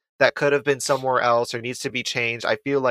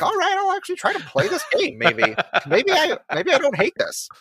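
put-on high, quick kid-like reply; lightly hammed-up little kid voice; voicing child self. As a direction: pitch it up like a kid coming round. Acted reply of his child self.

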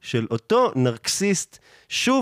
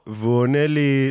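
ordinary talk, nothing marked

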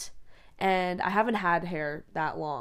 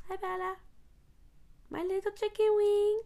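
much higher, cooing pet voice; 13 semitones higher than usual. Real, not acted. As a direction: coo at the dog up high. Talking to her dog.

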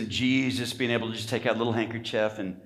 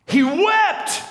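He wept, shouted much higher and louder; cried out; 7 dB louder than usual. Real, not acted. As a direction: hurl "He wept" out at the peak of the sermon. Preacher climaxes, crying it out.

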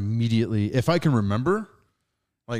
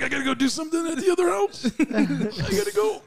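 high, hurried, squeezed voice; mock choked-up excuse; 13 semitones higher than usual. Uncle fleeing his nephew's sad movie, high mock choked-up excuse. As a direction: rush out an excuse as if holding back tears. Acts fleeing before crying at film.

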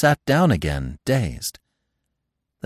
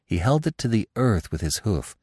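usual speaking voice

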